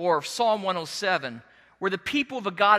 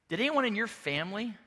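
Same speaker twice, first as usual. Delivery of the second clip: ordinary talk, nothing marked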